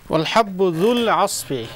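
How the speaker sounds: wal habbu dhul asfi with the dhal of dhul hit crisply and loud; clear zal. Shown as a demonstration. Teacher modelling the verse with a crisp zal. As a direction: bite down on the dh in dhul. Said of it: "You know the zal here".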